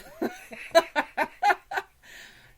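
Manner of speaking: big burst of laughter; loud laugh. Real, not acted. Laughing at own joke.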